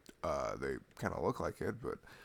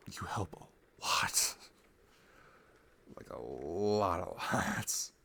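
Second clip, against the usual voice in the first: slow tender whisper; much slower than usual. Real, not acted. Reassuring his girlfriend she helps a lot, in a slow tender whisper. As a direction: let each word linger, barely voiced.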